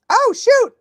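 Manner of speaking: high, loud startled cry; startled oh shoot. Real, not acted. Vlogger noticing the episode already started, high startled cry. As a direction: realize you missed it. Missed the video.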